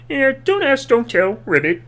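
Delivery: lightly voiced Kermit with a small ribbit; Kermit the Frog. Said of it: "And Kermit's just like".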